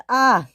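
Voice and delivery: short, high acted cry; alarmed yelp. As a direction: yelp as you start to slip. Stuck engine cries out.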